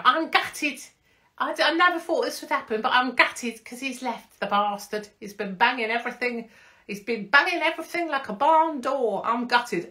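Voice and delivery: high put-on voice of a jilted influencer; mocking Molly voice. Impersonating the dumped girlfriend's statement, high and hammed-up. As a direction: whine it high and theatrical. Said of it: "Is this how she speaks?".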